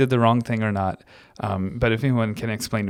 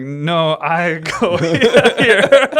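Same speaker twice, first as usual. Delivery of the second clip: half-laughed quote, high and loud; laughing retelling; 4 semitones higher, 5 dB louder, much slower than usual. Retold or imitated. Retelling the airport argument, laughing. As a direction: giggle through the protest. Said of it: "was like".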